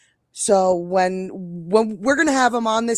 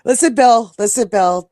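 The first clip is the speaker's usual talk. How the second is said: put-on New York accent; 9 dB louder than usual. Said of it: "you love my New York accent".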